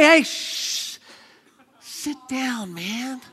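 a friend hushing a bold believer; hushed. Acted. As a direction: shush them down.

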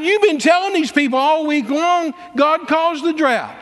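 high, slow, accusing outburst; heated accusation, words drawn out; angry rebuke. Retold or imitated. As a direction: re-enact telling them off at full pitch. Retold angry rebuke of preachers.

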